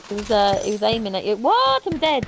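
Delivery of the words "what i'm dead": high, surprised what; startled outburst. Real, not acted. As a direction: caught off guard. Sudden death in game.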